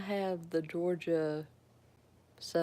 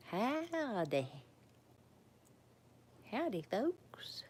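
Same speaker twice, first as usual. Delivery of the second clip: lightly put-on Texas twang, swooping pitch; play-acted Texas howdy, drawn out; high, playful Texas drawl; mock Texan greeting; 6 semitones higher, 3 dB quieter, much slower than usual.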